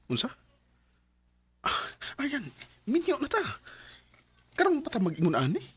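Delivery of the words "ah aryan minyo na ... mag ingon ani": high, pained protest to his wife; upset protest. Acted. Husband protesting to his wife about a cool off, upset and pitched high. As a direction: plead in disbelief, pitched up high.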